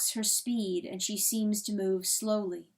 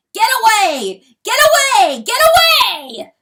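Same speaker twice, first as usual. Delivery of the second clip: much higher and louder, thundering; thundering roar; 13 semitones higher, 18 dB louder than usual. A narrator's reading. Rooster thundering at hen to go, furious roar. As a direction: bellow it over and over. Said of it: "the rooster thunders".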